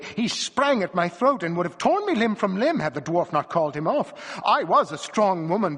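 dramatic, high-pitched recounting; agitated retelling. A narrator's reading. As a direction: relive it with rising voice. Wife relives the dog's attack.

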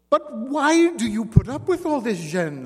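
angrily demanding why she endures it; angry. Acted. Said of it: "Simon Lafleur asked angrily".

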